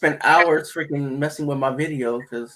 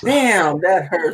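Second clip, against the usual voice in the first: higher, louder playful wounded reply; mock hurt; 5 semitones higher, 5 dB louder, much slower than usual. Answers teasing jab.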